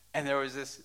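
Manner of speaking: ordinary delivery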